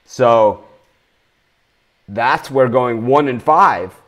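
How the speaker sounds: usual speaking voice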